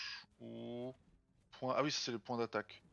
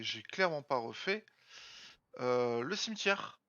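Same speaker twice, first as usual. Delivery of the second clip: ordinary talk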